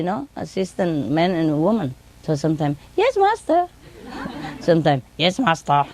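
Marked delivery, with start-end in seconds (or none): 3.0-3.7 high-pitched mimicked servant; boy's voice. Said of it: "Sometimes say it in a boy's voice". 5.2-5.8 lightly imitated male assistant; man's voice. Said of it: "sometimes say it in a man's voice".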